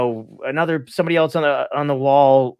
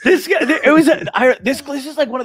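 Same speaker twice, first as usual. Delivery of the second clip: much higher, gasping through laughter; can barely speak for laughing; breathless laughing exclamation; 12 semitones higher than usual. Real, not acted. Overcome with laughter.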